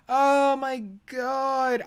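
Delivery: long, high drawn-out exclamation; exasperated disbelief. Real, not acted. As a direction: stretch every word in fed-up disbelief. Exasperated at repeated argument.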